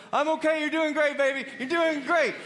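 hammed-up wife saying you're doing great; mock wife coaching. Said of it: "so my wife".

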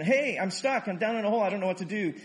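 high, loud mock cry from a hole; mock scream for help. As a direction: call out as if stuck, half-played. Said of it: "hearing the person screaming".

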